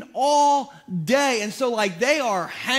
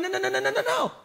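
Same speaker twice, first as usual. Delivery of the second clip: rapid-fire high-pitched mock refusal; comic string of protests rising higher; frantic objection; 10 semitones higher, faster than usual. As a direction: cut him off with climbing, high noes. Cutting off the storyteller, retold.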